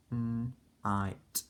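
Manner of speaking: "n, I, t" as separate letter sounds; sounding out. Shown as a demonstration. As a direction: say "n, I, t" one sound at a time. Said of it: "sounding out each sound".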